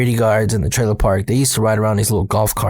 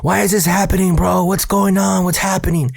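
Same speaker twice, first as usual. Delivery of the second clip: retold panicked yelling; 8 semitones higher than usual. Friends on bikes yelling at each other, panicked. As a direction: imitate panicked yelling up high. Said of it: "we're just yelling at each other".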